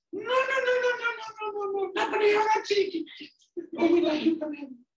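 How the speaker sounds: very high, loud pig squeal; scared squealing pigs. Acted. Scared pigs refusing the wolf, shrill squealing. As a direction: squeal it in a panicked tiny pig voice. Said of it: "the three little pigs said".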